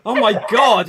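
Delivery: hammed-up prudish gasp of surprise; mock shock. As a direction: play scandalised at the thought. Mock shock at actor undressing.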